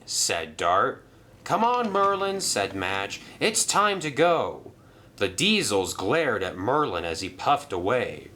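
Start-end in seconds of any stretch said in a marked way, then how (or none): 1.4-4.7 higher, coaxing; urging Madge. Acted. Madge urging Merlin to leave, higher and coaxing. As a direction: get him out quickly.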